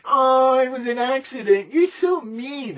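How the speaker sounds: very high, loud mock-innocent whine; passive-aggressive wife. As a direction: play the wounded innocent wife, drawing out you're so mean slowly. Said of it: "her little passive-aggressive psychopathic ways".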